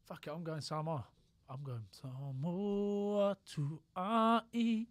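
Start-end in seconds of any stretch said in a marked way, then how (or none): 1.5-4.9 slow, loud, stretched-out proclamation; mock-grand slow declaration; drawn-out announcement. Drawn-out dramatic pick.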